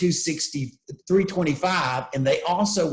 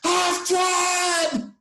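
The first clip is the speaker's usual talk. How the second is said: much higher, exasperated outburst; escalated exasperation. Retold or imitated. Kevin snapping back at the engineers, exasperated outburst. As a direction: burst out, fed up with the obvious advice. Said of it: "in an animated, escalated voice".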